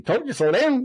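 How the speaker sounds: high-pitched mock call, no extra volume; imitated calling out. Officers passing the winded lieutenant, high mock call. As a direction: toss it back like someone running past.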